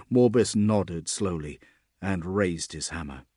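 plain reading voice

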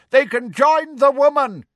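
high, loud centaur shout. A narrator's reading. Centaur condemning the captives amid roars of approval, shouting. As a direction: yell it out over the crowd. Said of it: "a dun-coloured centaur shouted".

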